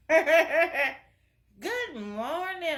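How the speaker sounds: good morning said slowly through a laugh; laughing greeting. Real, not acted. Greeting the viewers, laughing slowly.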